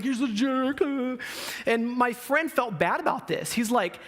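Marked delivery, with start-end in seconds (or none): 0.0-1.2 high-pitched mock complaint; whining bully. Bully stumbling back to his seat, complaining. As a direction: imitate a beaten bully moaning, voice pitched up.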